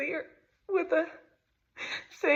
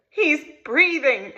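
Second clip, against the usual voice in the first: slow, louder called-out news; 5 semitones higher, 7 dB louder, slower than usual. Retold or imitated. Neighbors calling out from the woods, slow and loud. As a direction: call it out from the woods.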